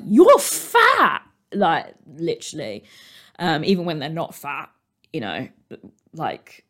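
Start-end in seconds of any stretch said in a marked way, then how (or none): 0.0-1.2 very high and loud, bluntly; mock yell at a relative; blunt shout. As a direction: blurt it like a tactless relative. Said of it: "they'll be like".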